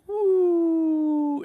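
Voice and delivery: high, long falling "woo"; sustained high swooping sound effect; zooming whoosh. Acted. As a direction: imitate a fast zoom with a drawn-out whoo. Imitates the video zooming back.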